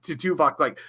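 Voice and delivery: ordinary delivery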